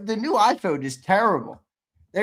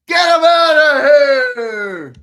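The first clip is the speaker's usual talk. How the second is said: shout; 8 semitones higher, 12 dB louder, slower than usual. Real, not acted. Kicking the loser out.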